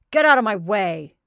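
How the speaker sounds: loud surly bark. Acted. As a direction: shove the words out gruffly. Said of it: "in a surly way".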